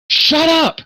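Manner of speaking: very high, loud shout; elf snapping back. Acted. Elf to woman behind him, high exasperated shout. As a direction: cut them off with a sudden high yell. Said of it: "he goes".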